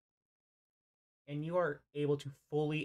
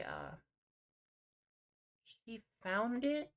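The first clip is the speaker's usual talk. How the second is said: slow, unsure creature voice, a little higher; Thorn, hesitant; 5 semitones higher than usual. Acted. Game master voicing Thorn answering the party, slow and hesitant. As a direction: stumble through it like a shy child. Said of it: "And Thorn replies".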